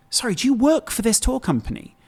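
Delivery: high-pitched, pointed retold question; indignant challenge. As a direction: challenge someone who has butted in. Retold challenge to interfering woman.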